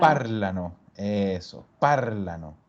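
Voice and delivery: parlano stressed on the first syllable par, not on ano. Shown as a demonstration. Teacher confirming the answer, stress on par. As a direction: put the weight on par in parlano. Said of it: "Si no carga en la terminación".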